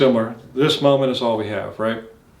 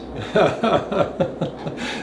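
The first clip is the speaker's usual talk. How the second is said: light airy chuckle; a quiet amused laugh; soft laugh; 5 semitones higher, 5 dB quieter than usual. Real, not acted. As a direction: laugh at your own cliche. Laugh after cliche.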